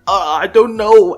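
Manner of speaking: loud, flustered burst; overwhelmed outburst. Acted. Student explaining the attack to his mentor, overwhelmed. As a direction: blurt it out at a loss.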